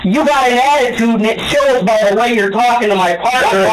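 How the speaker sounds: high-pitched furious scolding, easing slightly; angry tirade. Real, not acted. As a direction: lecture him hotly from a shouted start. Detective berating suspect in quarrel.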